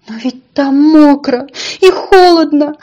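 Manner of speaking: high, loud wail of protest; distraught little donkey pleading; despairing cry. A narrator's reading. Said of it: "в отчаянии закричал маленький ослик".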